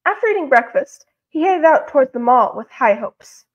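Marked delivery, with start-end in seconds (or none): none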